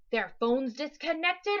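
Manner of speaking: loud monster voice rising on disconnected; booming monster. Acted. Monster feeding Max the lie, booming loud. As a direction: dictate the lie like a giant.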